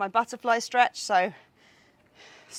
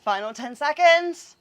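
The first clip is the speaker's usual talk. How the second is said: drawn-out coach call, louder; slow loud countdown; 4 semitones higher, 8 dB louder, slower than usual. Real, not acted. Coach calling final countdown.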